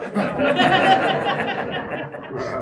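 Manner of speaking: high, rolling real laughter. Real, not acted. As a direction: break up laughing. Laughs at his own joke.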